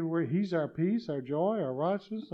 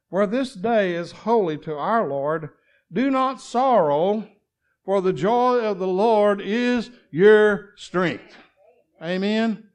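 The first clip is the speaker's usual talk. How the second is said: slow, loud, ringing proclamation; preaching at full force; 4 semitones higher, 9 dB louder, slower than usual. Acted. Preacher proclaiming scripture to the congregation, slow and loud. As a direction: lean on every word of the promise.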